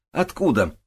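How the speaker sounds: high-pitched, urgent question; squealed question. A narrator's reading. Sergeevna demands the knife's origin, squealing. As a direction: fire the question out shrilly excited. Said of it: "взвизгнула Сергеевна".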